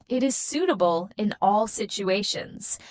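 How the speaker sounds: normal talking voice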